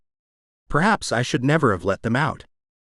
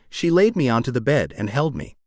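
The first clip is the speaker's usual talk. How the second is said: ordinary delivery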